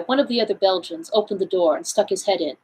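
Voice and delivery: plain reading voice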